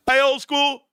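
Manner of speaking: mock call-out, pitched well up; high hailing call across a court. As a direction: imitate a kid hailing the old guy. Young players calling him over.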